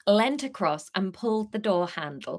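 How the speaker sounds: plain reading voice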